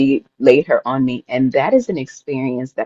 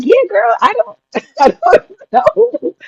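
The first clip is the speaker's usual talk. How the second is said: high, loud squeal breaking into laughter; hammed-up high-pitched straining voice; strained retold reply; 5 dB louder than usual. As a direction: squeal it as if mid-push, laughing. Retold answer while pushing, laughing.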